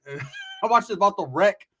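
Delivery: ordinary talk, nothing marked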